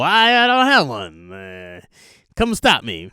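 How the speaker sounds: slow, dopey villain drawl; lazy villain, no plan at all; aimless villain. Acted. Villain telling the hero to stop him, slow and dopey.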